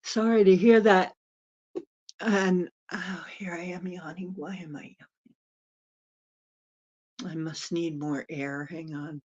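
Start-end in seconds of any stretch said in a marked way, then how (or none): 2.2-7.4 breathy whisper through a yawn; sleepy whispered aside; yawny whisper. Real, not acted. Yawning aside to viewers.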